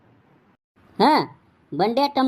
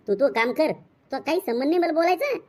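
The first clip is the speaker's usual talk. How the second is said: ordinary delivery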